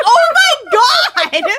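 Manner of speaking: slow, high shout of horror; shocked shout. Retold or imitated. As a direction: scream it out, stunned. Horrified realisation of dead dad.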